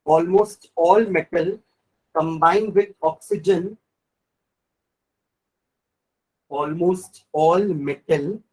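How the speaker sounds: slow, word-by-word dictation with pauses. Shown as a demonstration. Teacher dictating notes to the class, slow and deliberate. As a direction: spell it out slowly so the class can write.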